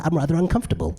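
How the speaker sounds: high-pitched mock unease; uncomfortable audience member. Comic quoting his new routine, high mock unease. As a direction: put on a prim, uneasy voice. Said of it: "a new one I've just developed".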